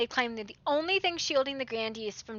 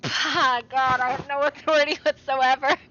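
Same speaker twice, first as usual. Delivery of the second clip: laughing despair; 5 dB louder than usual. Real, not acted.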